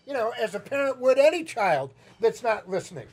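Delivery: ordinary talk, nothing marked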